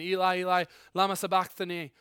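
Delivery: a dying man calling out to God; yelled cry. Acted. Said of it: "And he yells out".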